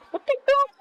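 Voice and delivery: high, loud shocked yell; shocked outburst. Real, not acted. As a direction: blurt it out in disbelief. Sudden disbelief at play.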